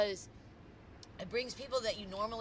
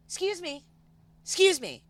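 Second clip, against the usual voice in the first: high-pitched calling out, second one louder; insistent businesswoman; 11 semitones higher, 10 dB louder than usual. Retold or imitated. Businesswoman stopping the jacket's wearer, insistent high call. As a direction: imitate a woman flagging someone down.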